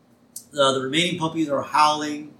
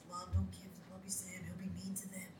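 put-on high, very soft child's whisper; Lisa; 5 semitones higher, 20 dB quieter, faster than usual. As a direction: whisper a worried plea as a little girl. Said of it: "Lisa whispers to Marge".

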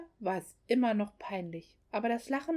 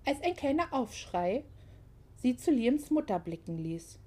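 plain reading voice

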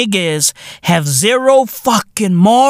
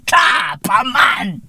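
ordinary delivery

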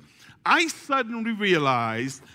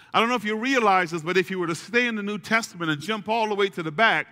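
ordinary talk

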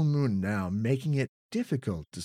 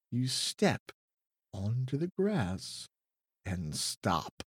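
hushed narration; 4 semitones lower, 5 dB quieter, slower than usual. A narrator's reading. Suspense narration creeping.

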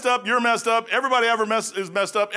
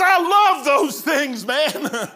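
high, slow, louder nurse voice, laughing at the end; gushing nurse; 6 semitones higher, 5 dB louder, much slower than usual. Retold or imitated. Preacher imitating the nurse gushing over tracts, slow and high. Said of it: "She says".

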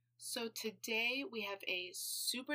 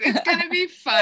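higher, much louder, hammed-up cheerfulness; mock cheery; 10 semitones higher, 19 dB louder than usual. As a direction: play up the fake bright promise. Host mimicking her own cheery promise.

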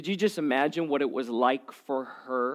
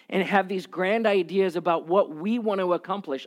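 usual speaking voice

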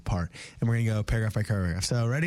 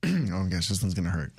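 ordinary delivery

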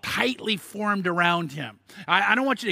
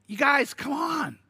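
higher, slow mock-scolding call; exasperated appeal; 6 semitones higher, slower than usual. Real, not acted. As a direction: plead with the room, come on. Preacher scolding congregation.

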